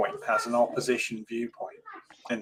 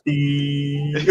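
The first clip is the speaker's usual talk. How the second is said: monotone beep; 3 semitones higher than usual. Retold or imitated. Joking about a radio host's monotone, one long held beep. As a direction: imitate a droning tone, stretched right out.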